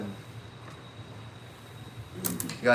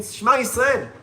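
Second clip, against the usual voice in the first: loud, high, fervent Shema Yisrael; a ringing declaration of faith; fervent cry; 9 semitones higher, 8 dB louder, slower than usual. Acted. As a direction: cry it out with all your soul. Akiva's cry retold with fervour.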